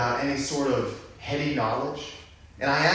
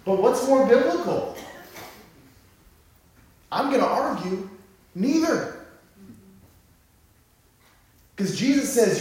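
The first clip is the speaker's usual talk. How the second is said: higher, louder preaching, slowing on key lines; preaching at full force; 8 semitones higher, 7 dB louder than usual. Acted. Pastor urging the church to seek God's overflow, full-force preaching. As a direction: drive it home to the congregation.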